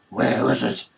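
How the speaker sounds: a rough Batman growl with his timbre changed. Acted. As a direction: menace it like Batman asking. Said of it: "he sounds like Batman".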